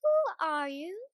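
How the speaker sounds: lofty, stately question; very grand voice. A narrator's reading. The Queen answering the gate bell, grand voice. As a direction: sound terribly grand. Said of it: "said a very grand voice".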